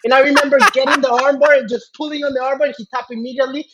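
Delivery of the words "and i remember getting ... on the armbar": normal talking voice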